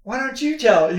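high, loud mock daughter; a hint of a pushy daughter; lightly voiced teen turning it back on dad; imitating daughter. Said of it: "she'll come after me and say".

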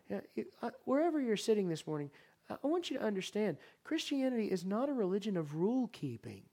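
ordinary talk

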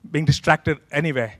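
ordinary talk, nothing marked

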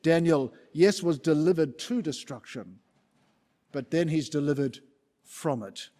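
ordinary talk

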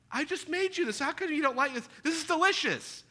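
exasperated parent. Retold or imitated. Parent defending the meal to the child, high exasperated. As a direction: plead with the picky eater, high and put-upon. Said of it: "kids shouldn't say".

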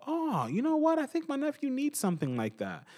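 mock shopper. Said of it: "and they go".

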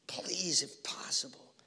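hushed, slow desperate plea; pleading whisper. Acted. Jesus begging his Father in the garden, whispered. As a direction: whisper it like a broken prayer.

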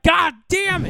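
high, loud mock outburst; exasperated yell. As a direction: throw up your hands and yell. Imitating partner's reaction.

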